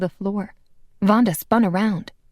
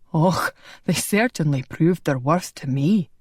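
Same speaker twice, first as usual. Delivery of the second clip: a hint of a kindly Scot over the lad; Scottish brogue; 3 semitones lower than usual. Angus voiced.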